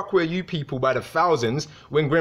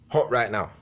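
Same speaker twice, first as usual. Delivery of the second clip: ordinary talk, nothing marked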